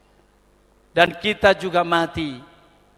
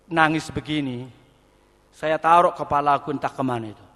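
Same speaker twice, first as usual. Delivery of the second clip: ordinary delivery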